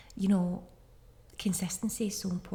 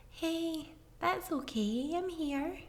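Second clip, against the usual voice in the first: put-on higher, softer, tender hush to a crying child; soothing comfort; 9 semitones higher, 3 dB quieter, slower than usual. Shown as a demonstration. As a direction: coo it gently like holding a child. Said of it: "hug them and hold them and say".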